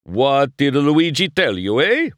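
high, loud Italian rapture; rapturous Italian shopkeeper. Acted. Luigi showing off his whitewall tires, rapturous Italian. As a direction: sell the whitewalls like a love song.

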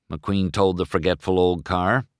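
usual speaking voice

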